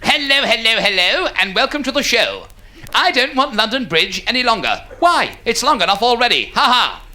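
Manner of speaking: put-on old-time comic with a forced "ha ha"; corny club comic. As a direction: rattle out a corny stage gag. Voicing a hack comic on stage.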